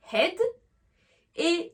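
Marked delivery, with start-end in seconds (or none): 0.1-0.4 head said with a short open è vowel; short è sound. Shown as a demonstration. Teacher showing learners the EA sound, head with short è. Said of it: "là j'ai fait le son est".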